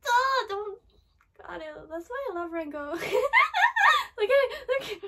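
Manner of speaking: high laughing shout, overjoyed; laughing squeal. Real, not acted. Fangirling over Rengoku.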